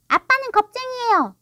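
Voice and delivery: put-on high, loud little-boy voice; taunting child. A narrator's reading. Small son calling his father out, taunting.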